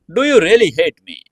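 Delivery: high, loud wounded plea; pleading captive. Acted. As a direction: ask it high and hurt. Captive spider pleads with captor.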